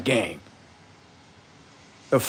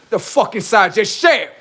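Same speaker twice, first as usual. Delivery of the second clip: ordinary talk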